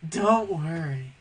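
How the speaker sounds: slow, lightly voiced imitation of the actress; a hint of Haley Steinfeld's flat delivery; mock actress read. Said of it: "she says it like this".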